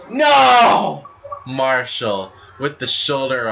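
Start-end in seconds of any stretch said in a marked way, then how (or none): 0.1-1.1 loud, high no at the kickout; shocked shout. Real, not acted. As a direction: yell in disbelief. Near-fall kickout at two.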